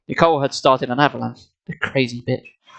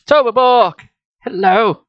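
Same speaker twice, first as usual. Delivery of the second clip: calling out; 6 semitones higher, 4 dB louder, slower than usual. A narrator's reading. Calling out into the pit.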